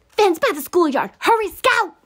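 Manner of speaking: high, very loud panicked yell; urgent shout. A narrator's reading. Brother shouting to sister fleeing a shotgun, urgent shout. Boy yelling as they flee gunshot.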